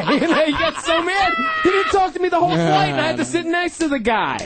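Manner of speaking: high-pitched laughing retelling; giggling disbelief. Real, not acted. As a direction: tell it through laughter.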